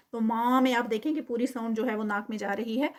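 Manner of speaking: ordinary talk, nothing marked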